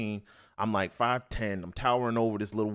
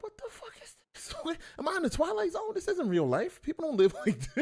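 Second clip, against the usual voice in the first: high, hushed disbelief, laughing; stunned and amused; a kid stunned by what he sees. Retold or imitated. Disbelief at the scene.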